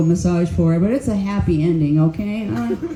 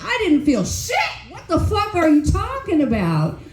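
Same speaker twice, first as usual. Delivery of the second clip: indignant; 8 semitones higher than usual. Retold or imitated. Complaining after Reiki.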